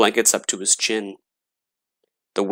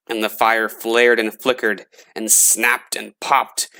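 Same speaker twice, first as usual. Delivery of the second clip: plain reading voice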